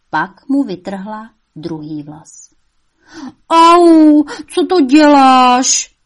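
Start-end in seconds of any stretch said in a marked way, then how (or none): 3.5-4.2 high, very loud cry of pain; devil's roar of pain. A narrator's reading. Devil roaring at his mother in pain. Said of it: "zařval ďábel vztekle". 4.2-5.9 higher, much louder, very slow angry question; furious devil roar. A narrator's reading. Devil roaring angrily at his mother. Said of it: "zařval ďábel vztekle".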